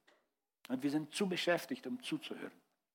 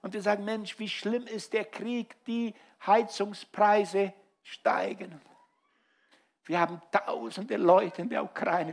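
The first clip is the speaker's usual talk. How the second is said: raised, driving pulpit voice; fiery preaching; 5 semitones higher, 6 dB louder than usual. Acted. Pastor urging the church to care, preaching at full force. Preacher exhorting congregation loudly.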